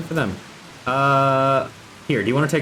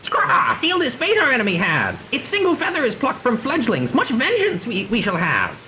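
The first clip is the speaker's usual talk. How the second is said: high, swooping squawky voice; outraged bird creature; 13 semitones higher than usual. Acted. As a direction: play a bird-person vowing payback.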